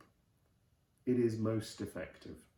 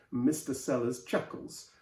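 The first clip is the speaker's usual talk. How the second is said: plain reading voice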